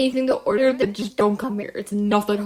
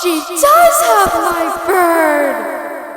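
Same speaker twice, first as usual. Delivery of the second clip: angry demand; 11 semitones higher, 14 dB louder, much slower than usual. Acted. Demanding the bird back.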